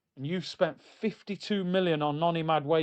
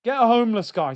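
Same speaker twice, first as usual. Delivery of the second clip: higher and louder, fed-up rant; 6 semitones higher, 5 dB louder than usual. Real, not acted. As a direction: demand it loudly. Ranting manager out.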